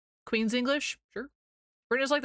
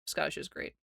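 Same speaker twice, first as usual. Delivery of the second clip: unvoiced whisper, much softer; 6 dB quieter than usual. Real, not acted. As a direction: whisper it like a secret. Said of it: "Scottish, Scottish is great".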